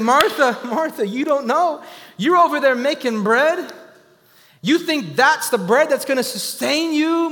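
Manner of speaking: high, loud, slow rebuke; Mary calls back to Martha about the bread of life, loud and high; mock yelling back. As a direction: call out to Martha from Jesus' feet. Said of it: "I'd be sitting here yelling at Martha".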